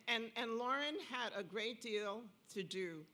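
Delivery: ordinary talk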